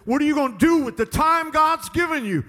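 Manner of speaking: high, loud pulpit challenge; full-force preaching. Real, not acted. Preacher challenging listeners about their time, forceful preaching. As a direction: throw the question hard at the crowd.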